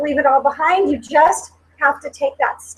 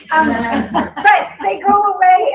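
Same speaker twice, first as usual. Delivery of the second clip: a laugh breaking into higher, louder words; half-laughing, half-crying, pitched up; laughing through tears; 5 semitones higher, 3 dB louder, slower than usual. Real, not acted.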